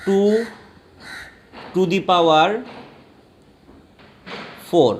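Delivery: "ଟୁ to the power four" in three chunks with long pauses; pronunciation demo. Shown as a demonstration. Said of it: "এইটাকে উচ্চারণ করবে".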